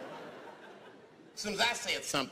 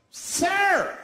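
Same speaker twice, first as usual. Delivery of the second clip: high, loud outraged call; sharp high cry of protest; freaked-out shout; 13 semitones higher, 7 dB louder than usual. Acted. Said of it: "And people freaked out".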